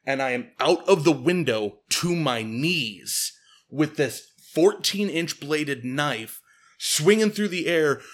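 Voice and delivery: usual speaking voice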